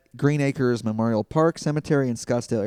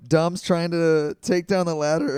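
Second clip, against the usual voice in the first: normal talking voice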